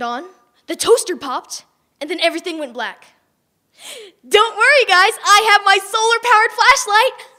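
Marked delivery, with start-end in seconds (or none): 4.3-7.3 high, loud proud offer; bragging helper voice; eager announcement. Acted. Proud rescue in the dark.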